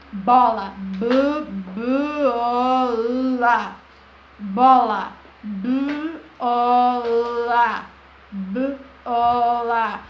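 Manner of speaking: Bola broken into b-, o-, la, each piece said loud; sounding out. Shown as a demonstration. Teacher showing how syllables join into words. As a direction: split Bola into slow separate sounds. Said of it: "juntar as sílabas".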